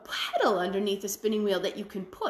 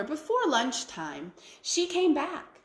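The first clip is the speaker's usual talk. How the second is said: ordinary delivery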